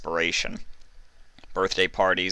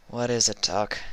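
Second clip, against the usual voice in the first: grumbling; 4 semitones higher than usual. Acted. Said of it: "she grumbled".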